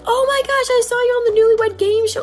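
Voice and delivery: high gushing fan voice; excited fan. Retold or imitated. Wife imitating cruise strangers, high and gushing. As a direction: gush like a starstruck stranger. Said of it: "people kept saying".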